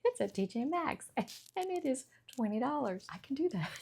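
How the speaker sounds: high, loud squeal of delight that settles into a giddy ramble; delighted at a bargain rack find; excited gush. Retold or imitated. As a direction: gush like a thrilled shopper spotting a bargain. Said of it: "And now I go".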